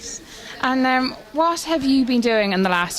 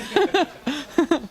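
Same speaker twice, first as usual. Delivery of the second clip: bursting into laughter; real laugh; 6 semitones higher than usual. Real, not acted. Laughter after the phrase.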